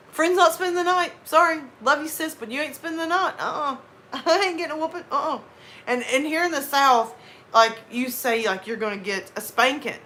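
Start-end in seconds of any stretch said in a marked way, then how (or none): 0.1-5.4 put-on higher, quick cheeky kid voice. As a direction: play the smug kid picking the easy way out. Kid choosing between punishments.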